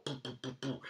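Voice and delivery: usual speaking voice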